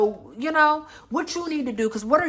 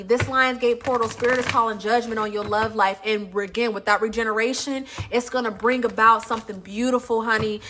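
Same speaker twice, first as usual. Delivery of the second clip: ordinary talk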